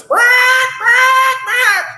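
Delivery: high, squawking quacks rising to a cry; shrill panicked quack-quack-quack; awful quacking. Acted. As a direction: squawk like a distressed bird. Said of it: "this awful noise".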